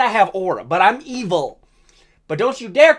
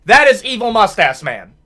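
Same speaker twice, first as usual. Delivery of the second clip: ordinary talk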